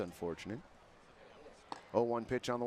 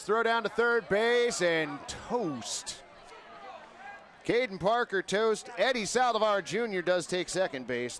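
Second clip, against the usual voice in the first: lively radio baseball call; play-by-play; 5 semitones higher, slower than usual. Acted. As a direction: call the action live.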